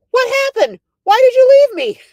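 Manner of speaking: high, loud mock wail of protest; imitating daughter's outcry. Daughter to the mother who left her home, imitated high loud outcry. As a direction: cry it out as an indignant, left-behind kid.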